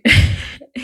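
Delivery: short breathy laugh; self-conscious chuckle; embarrassed laugh. Real, not acted. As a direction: laugh off a stumble over a word. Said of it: "English sometimes is just".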